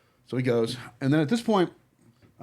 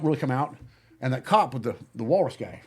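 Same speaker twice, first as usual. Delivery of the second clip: normal talking voice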